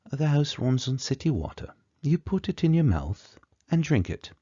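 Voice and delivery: high-pitched put-on traditional British accent. Shown as a demonstration. Lecturer demonstrating how traditional UK English says it, high put-on British accent. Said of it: "traditional U K, if you're saying this in English".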